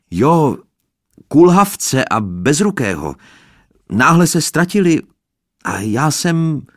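high, loud call upward. A narrator's reading. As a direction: call up to the balcony. Boy calling up to balcony. (chlapec volá k balkónu, hlasitě)

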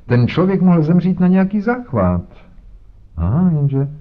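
pompous inspector. Acted. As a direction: lecture Holmes with condescension. Voiced inspector Jones talking. (nafoukaný inspektor, výš posazený hlas)

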